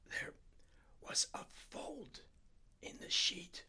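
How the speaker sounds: very slow, hushed whisper; frozen, breathless whisper. A narrator's reading. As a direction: whisper as if something sleeps on you. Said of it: "speaking more slowly than ever now".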